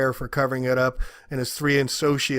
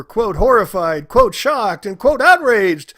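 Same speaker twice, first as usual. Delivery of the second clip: rising outrage; 6 semitones higher, 8 dB louder, slower than usual. Real, not acted. Outraged list of quoted words.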